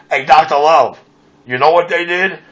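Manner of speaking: ordinary talk, nothing marked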